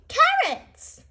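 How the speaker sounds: very high little bunny voice; Rebecca's voice. Acted. Rebecca Rabbit answers her teacher, squeaky high. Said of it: "says Rebecca Rabbit".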